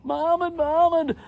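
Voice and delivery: high, drawn-out mock call; imitated cry for help; yelling out. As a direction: call the name in a high, pleading holler. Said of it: "I'm yelling out".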